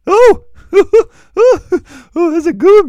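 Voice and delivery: bursting into loud laughter; loud, high delighted laugh. Real, not acted. Laughing hard at the joke.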